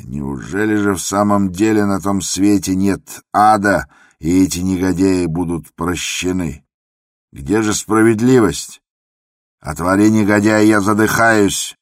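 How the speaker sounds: choking with rage, shout it out; hoarse shout. Acted. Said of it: "крикнул он сиплым голосом". (сиплый крик)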